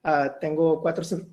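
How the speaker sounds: ordinary delivery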